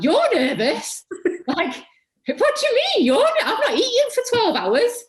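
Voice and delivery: very high, loud incredulous squeal through laughter; laughing disbelief. Retold or imitated. Student retelling her reply to nervous star teacher, incredulous and laughing. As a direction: shriek it in comic outrage, breaking into laughs.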